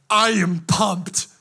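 slow, stretched-out, hammed-up declaration; loud mock-pumped, words drawn out. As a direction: announce it with exaggerated morning zeal. Acting out waking up thrilled.

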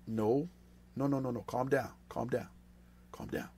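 soft, low, repeated hushing; hushed, fading reassurance; calming down. Acted. Calms an anxious, legalistic thought.

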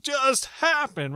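high, loud, very slow disbelieving cry; stunned disbelief. Retold or imitated. Imagining being overwhelmed in Peru, a stunned slow cry. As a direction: drag out the shock word by word. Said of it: "I would've been like".

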